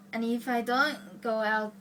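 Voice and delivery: ordinary talk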